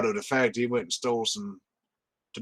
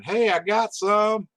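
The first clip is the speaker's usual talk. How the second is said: calling out; 11 semitones higher, 3 dB louder, slower than usual. Acted. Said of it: "he called out to his compatriots".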